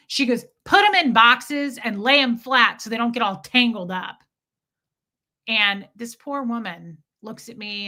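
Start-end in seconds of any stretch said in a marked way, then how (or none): 0.6-4.2 loud, slow bossy instructions; demanding customer. Retold or imitated. Rude customer quoting packing orders to clerk, bossy. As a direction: dictate it word by word to underlings. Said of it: "I'm literally quoting this woman".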